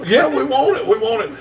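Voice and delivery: high, eager mock begging; retold begging, pitched well up. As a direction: plead for it like an excited listener. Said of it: "he's got him begging for it".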